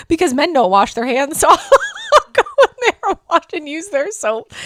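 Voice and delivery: giggling through the words; amused, laughing while telling it. Real, not acted. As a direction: laugh as you explain the joke. Laughing at own joke.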